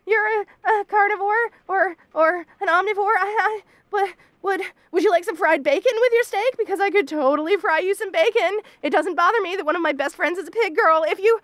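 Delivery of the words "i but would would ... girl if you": ordinary talk, nothing marked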